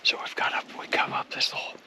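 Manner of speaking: hushed whisper on the move. Real, not acted. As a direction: whisper so the birds won't hear. Whispering while moving up the ridge.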